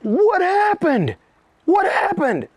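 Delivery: high, very slow stunned bodyguards; hammed-up shocked question; mock alarm. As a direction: drag it out in disbelief. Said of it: "they're like".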